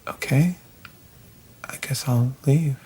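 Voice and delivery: hushed, half-whispered resignation; dejected murmur. Acted. Rejected lover agreeing to leave, hushed and resigned. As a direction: let it out almost without voice.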